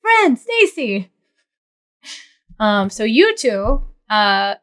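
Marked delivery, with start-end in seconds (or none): 0.0-1.1 high, drawn-out yell; yelling for colleagues. Acted. Rico yells for his fellow guards as he walks, high drawn-out call. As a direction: call out sharply for two people. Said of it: "he yells".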